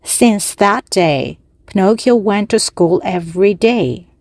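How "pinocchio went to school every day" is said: ordinary delivery, nothing marked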